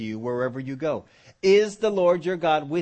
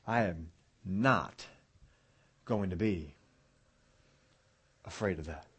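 firm resolve; 7 semitones lower, 6 dB quieter than usual. Shown as a demonstration. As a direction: space out each phrase, dropping softer toward the end. Spelling out a resolve word by word.

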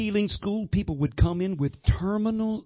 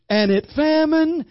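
preaching scripture; 9 semitones higher, 4 dB louder, slower than usual. Acted.

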